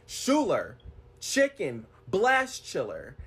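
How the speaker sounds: mock barked order. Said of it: "he screams at me".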